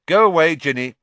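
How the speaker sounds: loud, high, brusque order; brusque dismissal. A narrator's reading. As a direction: send a little sister off loudly. Brother dismisses younger sister.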